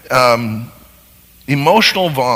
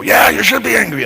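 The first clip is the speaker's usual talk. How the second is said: higher, louder mock egging on; 9 semitones higher, 6 dB louder than usual. Bad friend mockingly egging on angry speaker, loud and higher. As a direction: hype up the anger, half-mocking. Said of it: "And they, they go".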